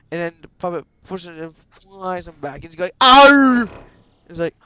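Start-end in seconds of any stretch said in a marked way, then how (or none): 3.0-3.7 loud, high "arr" yell; pirate scream. Acted. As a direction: scream as if flung backwards. Foxy flung back by puppet.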